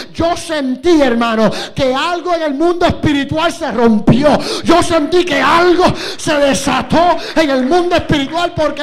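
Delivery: loud, high, climbing preacher's cry; full-force preaching. Acted. Preacher tells the church of forgiving his father, full-force preaching. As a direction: preach it out to the whole church, building.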